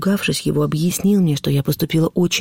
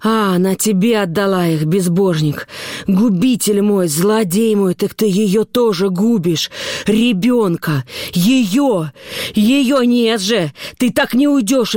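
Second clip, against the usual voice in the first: high, drawn-out wailing reproach; anguished, high-pitched raging; frantic accusation; 5 semitones higher, slower than usual. A narrator's reading. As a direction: cry out each accusation, building higher. Mother raging at the father.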